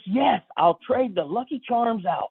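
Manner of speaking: high, slow, emphatic acceptance; eager friend imitation. Friend swapping cereal for Ghostbusters, acted glee. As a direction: act overjoyed, stretching it out up high.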